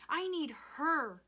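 high, loud, drawn-out cry; anguished scream. A narrator's reading. Rob needing his dead mother, screaming. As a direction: wail each word at full voice. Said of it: "he screamed".

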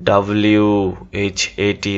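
"ডাব্লিউ এইচ এ টি" letter by letter; name each letter of "ডাব্লিউ এইচ এ টি"; spelled out. Shown as a demonstration. Teacher offering a wrong option to students, spelled out. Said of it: "এভাবে উচ্চারণ করব".

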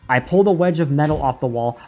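usual speaking voice

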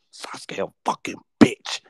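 usual speaking voice

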